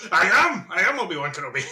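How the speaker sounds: high start, settling as it goes; imitated joyful declaration. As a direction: playfully imitate a delighted actor announcing himself. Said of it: "like, the joy in his face".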